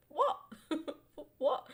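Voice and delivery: high incredulous What. Real, not acted. Streamer reacting in disbelief to a rule, incredulous.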